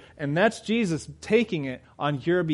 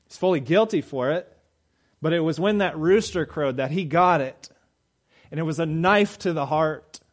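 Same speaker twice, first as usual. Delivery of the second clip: rising, louder preaching push; 4 semitones higher, 5 dB louder than usual. Acted. Preacher to congregation telling of Peter's denial, louder preaching. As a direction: drive the story home from the pulpit.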